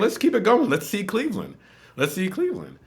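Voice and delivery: high-pitched gung-ho urging; acted-out keen enthusiasm; retold eager thought.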